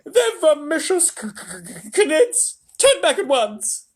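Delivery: high, loud frantic screech; panicked old grandma. A narrator's reading. Grandma Josephine begs Wonka to turn back, shrieking. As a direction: stammer in terror then order.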